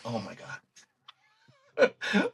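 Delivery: barely getting the words out, close to tears; choked-up awe. Retold or imitated. Retelling the moment his favorite album's fan praised him, choked up. Overwhelmed by the memory.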